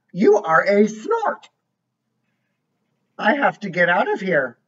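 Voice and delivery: lively storybook voice, each line set apart; reading to a child. A narrator's reading. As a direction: read it like a bedtime book for a little one. Reads picture book aloud.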